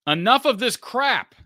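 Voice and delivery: high, loud and slow, hammed up; loud mock outburst. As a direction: bellow the viewer's complaint. Reading out a viewer's super chat.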